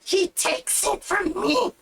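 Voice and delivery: put-on high, slow shrieking goblin girl. Goblin girl fighting the minotaur over the satchel, high shriek. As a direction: squeal it like a greedy little goblin.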